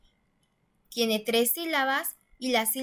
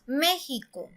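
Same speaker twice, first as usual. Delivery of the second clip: México with the weight on "Mé"; stress on first syllable; slower than usual. Shown as a demonstration. Teacher confirming the correct México, stress on "Mé". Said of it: "es la manera correcta".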